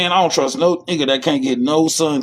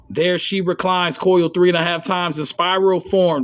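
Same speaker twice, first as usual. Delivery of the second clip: text reading; 3 semitones higher than usual. Acted. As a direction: lean into the old text. Reads the text aloud.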